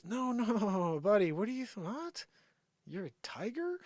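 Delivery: high, laughing disbelief; mocking incredulous voice. Ridiculing a man claiming to be a tiger, mocking. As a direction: play it like you can't believe it.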